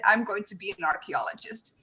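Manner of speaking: ordinary delivery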